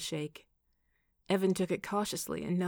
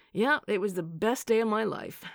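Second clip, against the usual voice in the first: grand, loud proclamation, pitched up; 4 semitones higher, 7 dB louder than usual. A narrator's reading. Noah boasts to Evan about meeting Kate, grandly. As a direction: declare it with sweeping self-importance. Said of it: "Noah said grandly".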